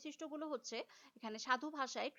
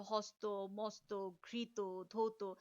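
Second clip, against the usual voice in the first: lower, measured word-by-word demo; a little grave; 5 semitones lower, much slower than usual. Shown as a demonstration. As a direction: weigh each word solemnly, with pauses between. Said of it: "এই উচ্চারণগুলো কিন্তু একটু গুরুগম্ভীর". (একটু গুরুগম্ভীর উচ্চারণে)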